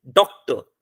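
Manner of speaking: Doctor with a slight break after डॉक; pause after first syllable. Shown as a demonstration. Teacher showing students the syllables, doctor with a slight break after डॉक. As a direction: say doctor in two beats, डॉक then टर. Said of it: "डॉक के बाद आप रुके".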